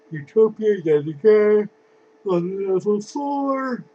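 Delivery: high, loud, slow exasperated complaint. Real, not acted. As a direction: groan it out, fed up. Exasperated at overused card.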